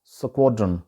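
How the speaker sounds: स्क्वाड्रन with a rounded "o", skwod-run; corrected pronunciation. Shown as a demonstration. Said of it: "इसका actual जो तलफ़ुज़ है".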